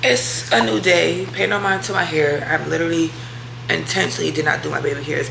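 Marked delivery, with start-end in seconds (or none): none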